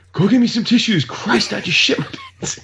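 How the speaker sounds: high, strained mock-panic yell; retold yelling. Dad selling an accident to the kids, mock yelling. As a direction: imitate him calling out in a panic. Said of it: "he's yelling at us".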